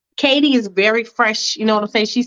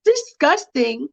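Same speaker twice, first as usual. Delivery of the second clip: 1 disgusted; 10 semitones higher than usual. Acted. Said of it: "And Mia's like".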